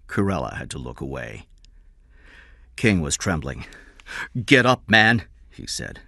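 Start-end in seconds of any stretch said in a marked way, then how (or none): 4.5-5.2 raised, louder, urgent order; agitated command. A narrator's reading. Employer tells kneeling father to get up, agitated command. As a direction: order him up sharply, voice lifted.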